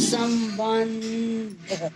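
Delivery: संबंध stretched slowly, म sounded like न; careful pronunciation. Shown as a demonstration. Teacher showing म said as न, slowly drawn. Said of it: "म का उच्चारण न का होता है".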